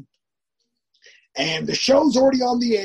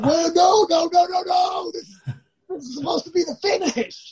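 panicked protest; 9 semitones higher than usual. Retold or imitated. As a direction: yell in sudden alarm. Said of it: "I said".

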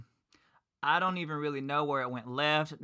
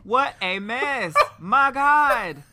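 loud disbelief; 12 semitones higher, 9 dB louder, much slower than usual. Real, not acted. Reacting to the drunk scene.

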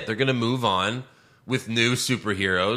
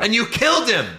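retold betrayed yell; 12 semitones higher, 4 dB louder than usual. Fan yelling at the director in the theater, hurt and loud. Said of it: "I was so emotional".

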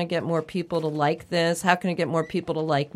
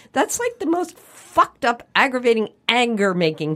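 angry; 6 semitones higher, 4 dB louder than usual. Real, not acted. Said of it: "I'm still so angry".

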